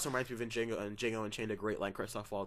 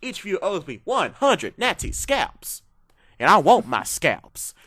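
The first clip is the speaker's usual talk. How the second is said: slow, loud Southern drawl, higher; Brad Pitt impression; 6 semitones higher, 12 dB louder, slower than usual. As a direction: bark it like Aldo Raine, slowly. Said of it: "scenes with him".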